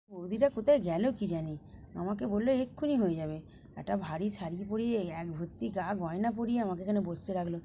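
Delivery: soft, low private grumble; hushed self-talk; grumbling to oneself. Acted. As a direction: complain under your breath while left waiting. Grumbling alone while left waiting.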